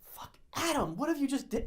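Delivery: high-pitched, exasperated dismay; retold alarm. Friends realizing Adam endangered them, retold alarm. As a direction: scold in mock panic.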